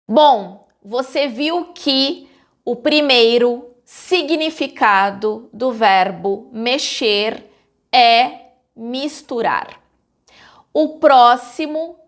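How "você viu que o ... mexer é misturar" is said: normal talking voice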